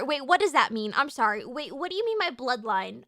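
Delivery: usual speaking voice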